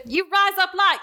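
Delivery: high mock Australian accent on rise up lights. Shown as a demonstration. Explaining the joke to listeners, high mock Australian accent. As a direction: demo the Aussie twang. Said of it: "if you don't know an Australian accent".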